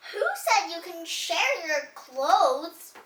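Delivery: high, loud outburst, then slow; shocked yell. Acted. Teacher shocked at shared clothes, high loud yell. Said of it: "The teacher yelled".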